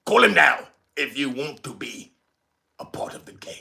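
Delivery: British voice. Pretend. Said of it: "Had to put my British voice on for you".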